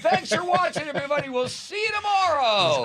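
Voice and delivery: very high, strained mock shriek; hammed-up TV sign-off. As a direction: sign off like a hysterical TV host. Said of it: "'Cause normally you'd like".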